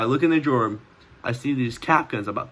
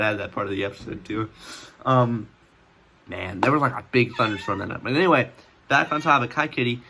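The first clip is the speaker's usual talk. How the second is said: normal talking voice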